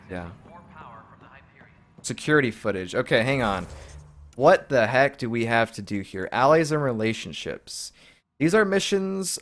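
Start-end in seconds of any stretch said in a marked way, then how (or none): none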